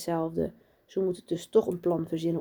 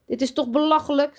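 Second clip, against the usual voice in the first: high, louder protest; indignant; 9 semitones higher, 6 dB louder than usual. A narrator's reading. Daan objects as Lucas is excused, indignant. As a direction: protest at the unfairness. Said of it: "kijkt verontwaardigd op".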